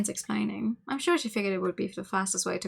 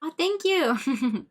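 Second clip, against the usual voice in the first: higher, very slow drawn-out thanks; exaggerated thank you; 7 semitones higher, 4 dB louder, much slower than usual. Real, not acted. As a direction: stretch out a gushing thank you. Overdone thanks.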